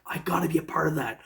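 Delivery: breathless eager whisper, a bit fast; whispered to himself. Retold or imitated. Whispering his wish to join the detecting event. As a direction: hush it like a secret wish.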